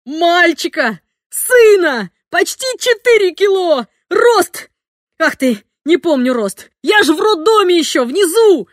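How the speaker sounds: high, loud excited blurting; father blurting baby news; excited shouting. A narrator's reading. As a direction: tumble out every detail in joy.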